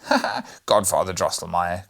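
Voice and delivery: loud laughter. A narrator's reading. Fred interrupts his godfather with loud laughter. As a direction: burst in with a loud mock laugh. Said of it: "interrupted him with loud laughter".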